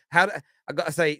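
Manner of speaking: ordinary delivery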